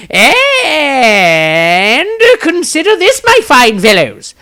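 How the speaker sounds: a bard rallying his companions with flourish; Maestro's grand voice. A narrator's reading. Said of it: "plucked his spirits up and said".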